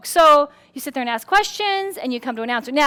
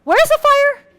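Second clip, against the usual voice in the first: puzzled; 9 semitones higher, 3 dB louder than usual. Retold or imitated. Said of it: "you're still figuring out".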